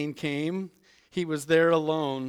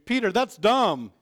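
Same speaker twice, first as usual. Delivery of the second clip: joking misheard line; 5 semitones higher, 6 dB louder than usual. Retold or imitated. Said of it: "maybe John heard Jesus say".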